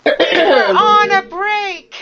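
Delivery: high, slow, crying whine; whiny Ross impression. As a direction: whine it like a hurt boyfriend. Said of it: "Friends line, quoted".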